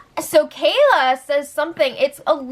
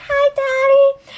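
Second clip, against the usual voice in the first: put-on much higher, slow little kid voice; child's voice; 14 semitones higher, 4 dB louder, slower than usual. Retelling the son greeting his dad, in a high child voice. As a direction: squeak it like a small boy.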